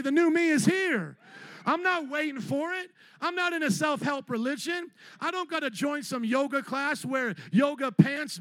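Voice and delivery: rally the church with every line; full-force preaching. Real, not acted.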